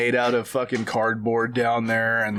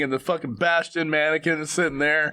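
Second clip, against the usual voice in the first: usual speaking voice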